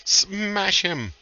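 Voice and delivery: high, drawn-out smash; rousing battle cry. Acted. Game master urging player to smash the wraith, rousing cry. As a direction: egg the fighter on with a high cry.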